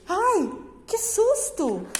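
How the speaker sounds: acted fright, high and drawn out; a teacher's mock startled yelp; startled exclamation. Shown as a demonstration. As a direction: demonstrate a little scare for the class. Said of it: "Exclamação".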